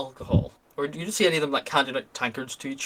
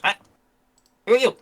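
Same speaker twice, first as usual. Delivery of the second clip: higher, louder goblin voice calling out a challenge; wary goblin challenge; 9 semitones higher, 6 dB louder, faster than usual. Acted. Goblin guard challenges strangers at the camp, wary and higher. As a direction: squint into the dark and call out like a wary goblin. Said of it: "peers through the dim light and goes".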